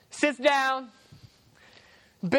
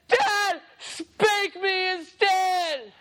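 put-on high, loud little girl crying out; pleading girl shout; 9 semitones higher, 3 dB louder, much slower than usual. Daughter bursting in, shouting to her dad to stop. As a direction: beg your father to stop at the top of your voice.

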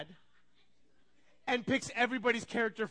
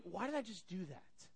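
much softer voice to oneself; inward self-questioning; 2 semitones lower, 10 dB quieter than usual. Acted. Person in their own head after failing, quiet self-questioning. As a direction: ask yourself quietly, like a thought. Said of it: "you sit in your head".

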